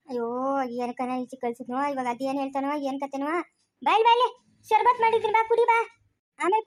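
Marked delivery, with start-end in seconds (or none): 0.1-3.3 worried mutter. Acted. Worried mother mutters aside.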